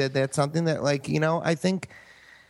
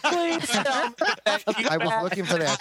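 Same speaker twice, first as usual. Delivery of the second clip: laughing; 7 semitones higher, much slower than usual. Real, not acted. Laughing at the cartoon bit.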